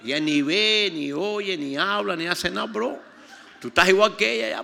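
loud, high preacher's retort; réplica burlona. Retold or imitated. (réplica burlona a voz en cuello)

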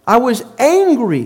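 usual speaking voice

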